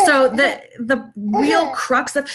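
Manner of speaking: ordinary delivery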